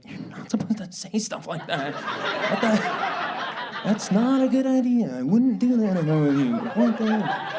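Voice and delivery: high, ineffectual mock protest; whining little brother. Democrats as little brother objecting helplessly, mock muttering. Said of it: "the Democrats are the little brother".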